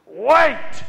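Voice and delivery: loud high yell; sharp loud call; shouted command. Retold or imitated. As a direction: stop someone dead with one shout. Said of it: "I hear a voice that says".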